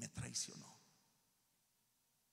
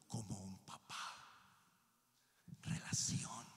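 whisper; 6 semitones lower than usual. Acted. Whispered intimacy.

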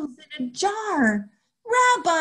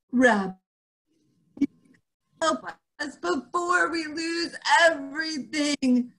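pleading lament; 9 semitones higher than usual. Acted.

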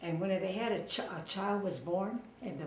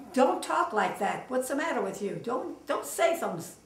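alarmed scolding; 4 semitones higher, 6 dB louder than usual. Retold or imitated. Husband scolding doctor friend about robbing bank, alarmed and louder. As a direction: tell him off for talking crazy.

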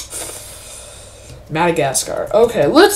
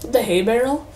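ordinary talk

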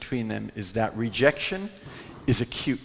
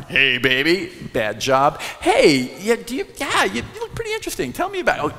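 high, fast cheesy come-on; hammed-up chat-up voice; mock pickup line; 6 semitones higher, 3 dB louder than usual. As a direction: play a slick flirt on the platform. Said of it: "you approach somebody on a platform".

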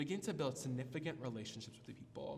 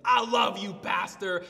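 imitated full-volume yell, pitched up; loud affectionate shout; 4 semitones higher, 12 dB louder, slower than usual. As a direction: holler it down a hallway with love. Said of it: "as loud as he could".

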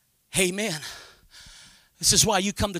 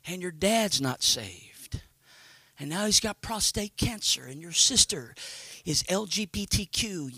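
retold little whispering voice; 7 dB quieter than usual. Little voice reproaching the preacher over his unsaved family, retold in a hushed tone. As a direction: imitate a quiet voice nagging in the ear. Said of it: "this little voice whispered in my ear".

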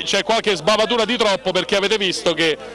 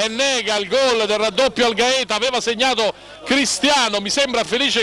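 ordinary delivery

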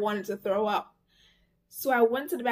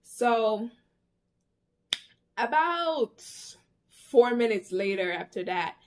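ordinary delivery

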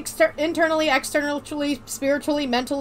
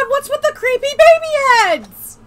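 shocked squeal; 11 semitones higher, 8 dB louder than usual. Acted. Repeat of the question.

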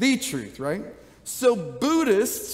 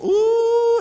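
high, loud mock-shocked ooh; dramatic ooh; 12 semitones higher, 7 dB louder than usual. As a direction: make the ooh a big theatrical gasp of alarm. Dramatic reaction to name Jesus.

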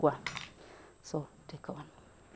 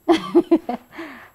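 bright laugh; 8 semitones higher, 6 dB louder than usual. Real, not acted. Happy crafting moment.

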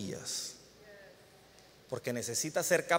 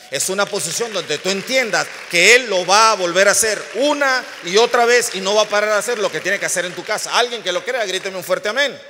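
louder, high, rousing preacher's call; full-force preaching; 4 semitones higher, 11 dB louder than usual. Acted. Preacher rousing the congregation to shout amen, loud fervent call.

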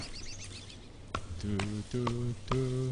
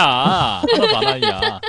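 high, slow laughing complaint; laughing whine; 3 dB louder, much slower than usual. Real, not acted. Mock complaint at teammate's greeting.

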